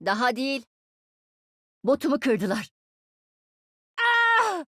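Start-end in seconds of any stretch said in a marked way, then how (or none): 4.0-4.7 tiz, kısa bir acı çığlığı; yüksek perdeden "ah" diye bağır. Acted. Oyun karakteri acıyla bağırıyor. (acıyla tiz çığlık)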